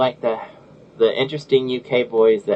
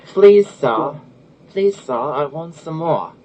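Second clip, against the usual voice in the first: attempted British accent; 4 semitones higher, 4 dB louder, slower than usual. Shown as a demonstration. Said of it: "I've tried to pronounce the U K type of stuff".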